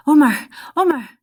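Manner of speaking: loud shouted name, high; calling out. Acted. Nemo calling Omar from a distance. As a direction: call his name across the camp.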